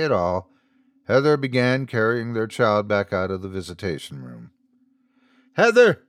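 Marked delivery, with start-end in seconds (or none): 5.5-6.0 high, loud call after her. A narrator's reading. Father calling after his leaving wife, loud and high. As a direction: call her back across the room. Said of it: "Andrew called after her".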